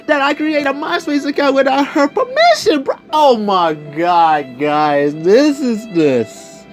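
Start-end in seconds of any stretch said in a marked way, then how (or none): none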